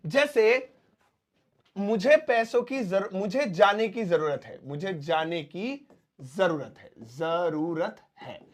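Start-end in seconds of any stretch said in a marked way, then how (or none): 4.7-8.5 slow, softer teaching read; slow dictation. Shown as a demonstration. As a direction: dictate it slowly for students. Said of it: "अगर ये मैं dependent model की तरह बनाऊंगा".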